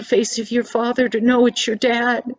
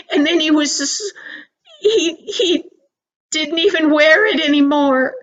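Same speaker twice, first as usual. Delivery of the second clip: voice breaking; 5 semitones higher, 5 dB louder than usual. Real, not acted. Narrator moved retelling boy's cruelty.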